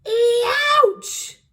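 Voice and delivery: huge high pained roar of "Ouch!"; roaring giant. A narrator's reading. Giant bitten on the tongue, a huge high pained roar. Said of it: "roared the blood bottler".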